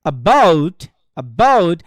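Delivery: "about" as a light "a" and a punched "BOUT"; stress on second syllable. Shown as a demonstration. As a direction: hit "bout" hard in "about", swooping up and dropping. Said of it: "তাহলে কেমন হবে দেখেন". ("about"-এর "bout"-এ জোর)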